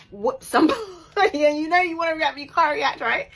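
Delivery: high, loud, laughing frustration; boiling over with a laugh; loud laughing exasperation; exasperated. Real, not acted.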